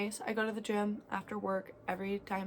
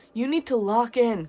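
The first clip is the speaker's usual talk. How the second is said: much louder repeat of the order; girl yelling the order a second time, louder; commanding shout; 4 semitones higher, 13 dB louder than usual. Retold or imitated. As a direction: shout it so he finally listens.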